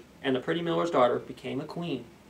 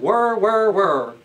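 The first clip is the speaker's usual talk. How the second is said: loud high whir whir whir like a spinning wheel; whirring wheel; 5 semitones higher, 7 dB louder, much slower than usual. Acted. Storyteller to children, imitating the spinning wheel loudly. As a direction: make the wheel sing out loud.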